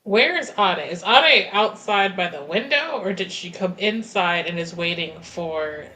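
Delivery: normal talking voice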